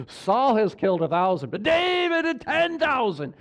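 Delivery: hammed-up adoring crowd, stretched out; townspeople swooning. Preacher as the town acclaiming David over Saul, gushing. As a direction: gush over a new hero, swelling at the end. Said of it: "what is the whole town saying?".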